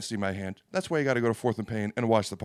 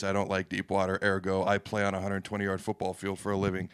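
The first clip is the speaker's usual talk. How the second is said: ordinary delivery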